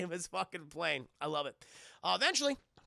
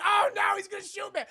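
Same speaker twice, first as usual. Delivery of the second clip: high, loud panicked yelling; hammed-up terrified scream; mock scream; 10 dB louder than usual. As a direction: shriek like a gun is pointed at you. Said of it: "He's just screaming".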